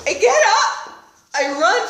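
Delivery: ordinary talk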